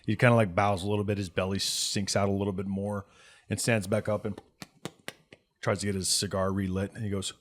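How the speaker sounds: ordinary talk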